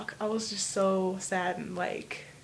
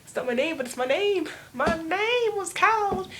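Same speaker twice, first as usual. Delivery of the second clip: rising higher and louder to a slow, drawn-out finish; excited; 10 semitones higher, 3 dB louder than usual. Retold or imitated. Teen finally hears her own name called, rising excitement. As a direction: squeal it, building up. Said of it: "I was like".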